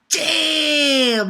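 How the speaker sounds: high, loud drawn-out damn; stunned fan shout; amazed shout. Retold or imitated. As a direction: call it out in awe. Reacting to the beastly dragon.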